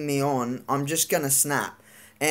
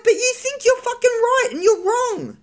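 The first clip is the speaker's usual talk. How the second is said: much higher, louder mock yell at dad; retold angry outburst; 5 dB louder than usual. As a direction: replay the shouting match with dad. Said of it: "I'm like".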